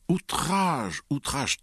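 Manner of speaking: high, loud indignant cry; outraged exclamation, stretched high; thundering outrage. A narrator's reading. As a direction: thunder it out, scandalized. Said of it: "Tenez, oncle Vania".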